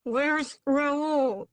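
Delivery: loud, high cry through a gag; muffled by a gag. A narrator's reading. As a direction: force the words out past a gag. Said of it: "the gag muffling all my words".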